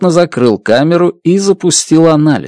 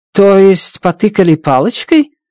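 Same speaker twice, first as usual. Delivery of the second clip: mocking; 7 semitones higher than usual. Strat mocks his method.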